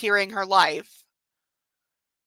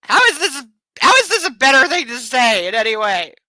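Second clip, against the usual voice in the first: exasperated outburst; 8 dB louder than usual. Real, not acted. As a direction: throw your hands up in loud disbelief.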